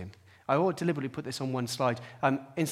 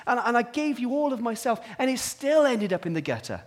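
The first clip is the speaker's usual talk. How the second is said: high, loud, impassioned complaint; pouring out protest; 10 semitones higher, 6 dB louder than usual. Acted. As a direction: cry out against the injustice. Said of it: "Elijah needs to pour out his heart".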